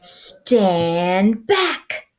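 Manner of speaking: a hint of a booming bully; lightly voiced boom. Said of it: "boomed a voice".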